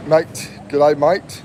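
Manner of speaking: ordinary talk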